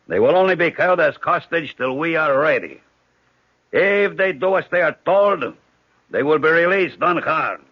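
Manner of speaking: loud, commanding orders; shouted orders. Acted. Hijacker sending the friends to first class, loud commands. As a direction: drive the hostages forward with a loud voice.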